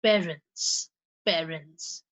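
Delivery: Parents with the first syllable PA stressed. Shown as a demonstration. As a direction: hit PA in Parents, rest light. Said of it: "P A".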